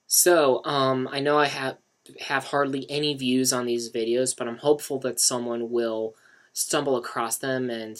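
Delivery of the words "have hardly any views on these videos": ordinary delivery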